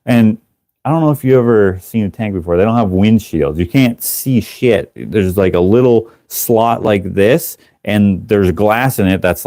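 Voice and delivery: normal talking voice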